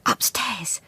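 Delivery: breathy urgent whisper. A narrator's reading. Beatrice whispering to Juliet, urgent whisper. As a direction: whisper it fast, don't be heard. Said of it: "she whispered urgently".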